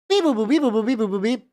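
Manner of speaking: beep-boop machine chant; toy robot voice. Pretend.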